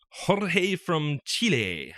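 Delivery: slow, drawn-out Spanish accent on the names. Shown as a demonstration. Host introduces the next listener's email, names in a Spanish accent. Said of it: "Nice accent there".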